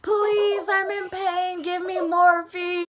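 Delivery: high, drawn-out begging wail; hammed-up whining demand for relief; yelling plea. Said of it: "I was yelling at the nurses".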